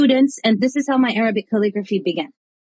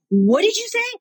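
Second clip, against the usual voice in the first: high-pitched, incredulous demand; retold shock flaring into anger; indignant disbelief; 9 semitones higher than usual. Chaplain reacting to monk snubbing her friend, indignant disbelief. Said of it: "the flipping anger came out".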